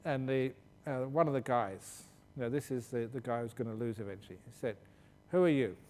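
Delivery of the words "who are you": mock challenge. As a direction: fire the question at the rival monk. Acted monks' debate, challenger asks.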